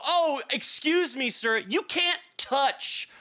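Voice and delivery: high, emphatic retold reprimand; indignant confrontation. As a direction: re-enact telling someone off, drawing words out.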